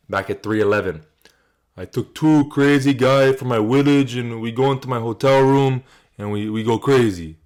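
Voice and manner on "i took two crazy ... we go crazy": slow, lightly put-on Czech accent; imitating Yuri. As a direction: mimic his halting post-fight English, drawn out. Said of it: "he did this post-fight speech".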